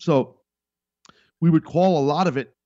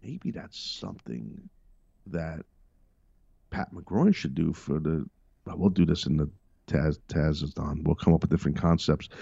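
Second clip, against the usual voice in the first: deep character voice; 9 semitones lower, 3 dB quieter than usual. Acted. Pitching a character idea in a very low voice. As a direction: drop way down into a comic low voice.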